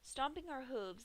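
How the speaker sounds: plain reading voice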